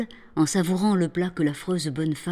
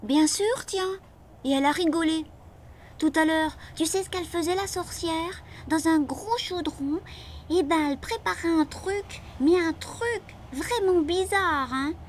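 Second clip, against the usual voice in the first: put-on much higher boy's voice, conspiratorial; little boy; 11 semitones higher than usual. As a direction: tell the secret like a small boy. Frédéric as child voice. (Frédéric raconte à Caroline, petite voix aiguë)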